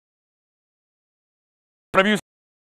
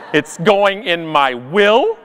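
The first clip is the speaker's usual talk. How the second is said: ordinary delivery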